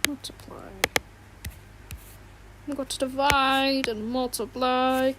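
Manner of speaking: odd, breathy teacher voice, whispered start; half-mocking Baldi character voice; Baldi impression. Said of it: "I am Baldi".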